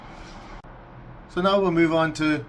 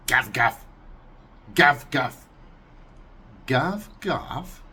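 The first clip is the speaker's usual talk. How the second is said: gav gav barked, louder, like a dog; Russian dog bark; 3 dB louder, slower than usual. Shown as a demonstration.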